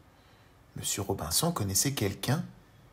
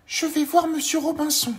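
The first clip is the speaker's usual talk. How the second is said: very high, louder little voice; tiny Monsieur Petit; 6 dB louder than usual. Acted. Tiny man greets the worm, very high voice.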